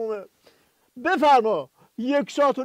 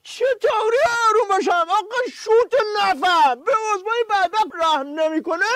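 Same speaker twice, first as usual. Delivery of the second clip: getting worked up and indignant; upset outburst; 6 semitones higher than usual. Acted. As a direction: yelps in outrage. Angry outburst, told to calm down.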